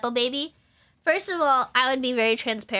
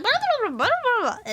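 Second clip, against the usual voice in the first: high rapid blah blah; babbling; 11 semitones higher than usual. Retold or imitated. Daughter babbling gamer talk at mom, high. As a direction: rattle off nonsense in a high voice. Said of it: "I'll be like".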